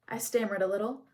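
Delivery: plain reading voice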